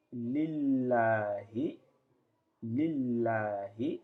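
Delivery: slow, drawn-out Arabic recitation of lillahi; Arabic reading demo. Shown as a demonstration. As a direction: stretch each syllable of lillahi slowly. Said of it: "ça se dit comme ça".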